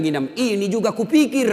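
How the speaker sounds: high, loud imitated comeback; loud retort. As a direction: act out the flustered imam answering a heckler. Imam snaps back at heckler mid-prayer.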